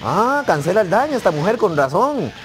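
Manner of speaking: high, loud cry of discovery; loud realisation. Real, not acted. Player discovering the character cancels damage, loud astonishment. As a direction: exclaim it as it dawns on you.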